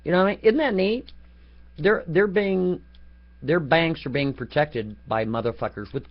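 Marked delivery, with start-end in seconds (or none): none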